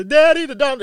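high, loud panicked cry for Daddy; a little girl screaming in terror; imitated terrified child. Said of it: "you could hear this little girl's terror in her voice".

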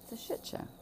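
hushed, voiceless aside; whisper. Real, not acted. Speaker muttering about a chaotic childhood, whispered. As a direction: drop to a bare whisper.

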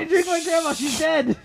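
high, loud exclamation; comic protest. Pretend. Storyteller answering the grandma mix-up, loud comic protest. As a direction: blurt it back in mock outrage.

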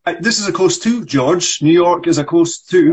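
a touch of an indignant actor; frustrated actor. Acted. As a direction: insist on being heard. S1 reads Michael.

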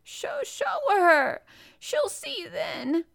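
high, loud, tearful wail; gasped between sobs. A narrator's reading. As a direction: sob it out slowly, drawn out. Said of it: "he gasped out between two sobs".

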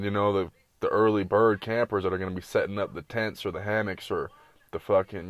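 ordinary talk

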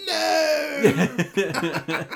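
high anguished no; anguished cry. Retold or imitated. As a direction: cry out no in despair. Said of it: "And he's like".

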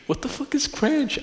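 aghast at ketchup ranch; shocked outburst. Real, not acted. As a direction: squeak it out in horror. Shocked at cranch.

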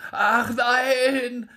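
hoch und laut, flehend; kleinlaut gerufen. Acted. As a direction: bettle hastig um Gnade. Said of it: "rief der Wirt ganz kleinlaut". (der geschlagene Wirt fleht den Drechsler hoch und verängstigt an)

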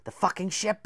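high, sudden shout; excited cry. A narrator's reading. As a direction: burst out with the idea. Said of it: "he cried".

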